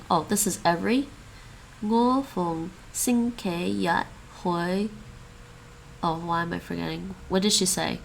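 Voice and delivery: ordinary talk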